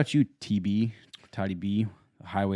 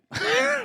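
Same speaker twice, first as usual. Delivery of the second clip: high laugh; 6 dB louder than usual. Real, not acted. Laughs at friend's workouts.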